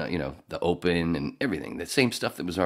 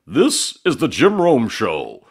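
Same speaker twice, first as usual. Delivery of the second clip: put-on big, slow, booming old-school announcer; loud, slow, larger-than-life announcer read; 7 semitones higher, 11 dB louder, slower than usual. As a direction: boom it out like a fifties radio open. Mimicking the old show open.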